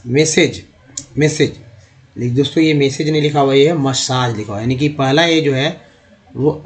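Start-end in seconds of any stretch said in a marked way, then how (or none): none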